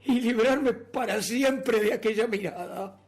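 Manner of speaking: loud, driven dramatic narration; mounting obsession. Acted. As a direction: raise the voice as obsession takes hold. Narrator's murderous obsession grows.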